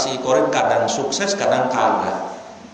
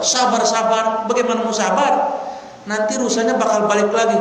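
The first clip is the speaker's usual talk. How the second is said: higher, indignant "sabar, sabar" thrown back; exasperated retort; 7 semitones higher than usual. Acted. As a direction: scoff at being told to be patient. Hunter rejects calming.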